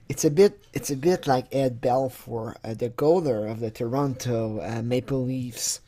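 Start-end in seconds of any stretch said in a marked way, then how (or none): none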